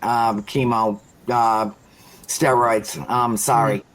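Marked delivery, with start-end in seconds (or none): none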